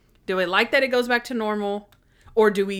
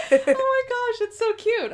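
much higher, laughing and delighted; gushing. Real, not acted. Fan gushing to her co-host, high and laughing. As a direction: squeal with delight at the ending.

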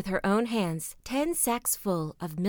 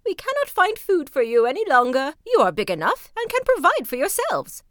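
loud, higher scolding raven voices; ravens crying out; 5 semitones higher, 7 dB louder than usual. A narrator's reading. Raven parents scold their young, loud and high. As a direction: chase the young ones from the nest. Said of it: "cried they".

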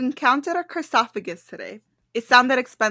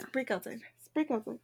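very soft, repeating it to oneself; musing quietly; 17 dB quieter, faster than usual. Real, not acted. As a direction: murmur it while puzzling it out. Puzzling over the clue to herself.

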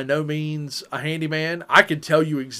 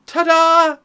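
mock fanfare; 13 semitones higher, 6 dB louder than usual. Showing off a new purchase.

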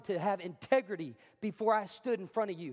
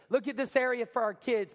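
usual speaking voice

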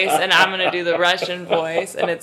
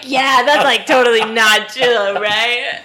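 put-on drunk voice; 4 semitones higher, 7 dB louder than usual. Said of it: "I'm like the drunk history".

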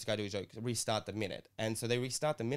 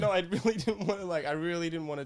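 laughing protest; 6 semitones higher than usual. Real, not acted. Laughs defending himself.

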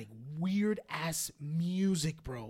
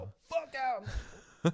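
shouting; 7 semitones higher, much slower than usual. Retold or imitated. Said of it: "kicked out of the house".

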